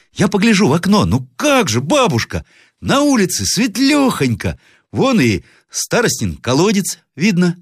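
put-on high, piping child's voice; excited boy. A narrator's reading. Grandson tells grandmother it is light outside, eagerly. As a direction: report it eagerly like a little boy.

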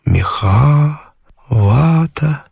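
plain reading voice

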